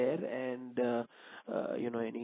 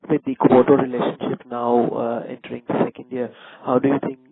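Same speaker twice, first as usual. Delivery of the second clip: much louder voice on a call; speaking up; 13 dB louder than usual. Real, not acted. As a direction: project the question so it carries. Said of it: "Can you please speak a bit louder".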